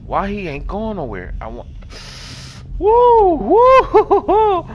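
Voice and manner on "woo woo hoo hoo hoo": high whoops, then a laugh; real high whooping relief. Real, not acted. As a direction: cheer your escape. Escaped, celebrating.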